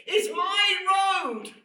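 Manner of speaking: high, slow, full-voiced shout of ownership; proud possessive yell; indignant shout. Real, not acted. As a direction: bellow it like it's yours alone. Ranting about his own road.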